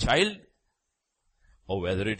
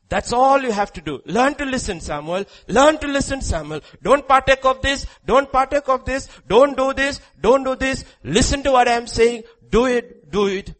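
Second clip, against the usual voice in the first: high, loud and pressing; preaching at full force; 11 dB louder than usual. Real, not acted. Preacher urging the congregation, high and loud. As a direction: hammer it home to the crowd.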